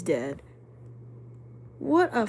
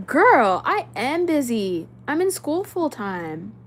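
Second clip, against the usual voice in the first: loud, drawn-out, higher-pitched pushback; sassy retort; 3 semitones higher, 7 dB louder, slower than usual. Real, not acted. Streamer answering a viewer who says she isn't busy, indignant. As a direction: push back slowly and emphatically, stretching it out.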